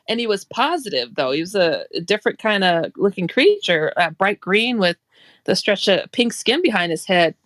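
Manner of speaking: ordinary talk, nothing marked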